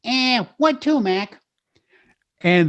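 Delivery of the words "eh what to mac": put-on higher Brooklyn cab driver accent; brash Brooklyn cabbie asking where to. Telling the Bugs Bunny origin story, Brooklyn cabbie accent. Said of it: "the cab driver says".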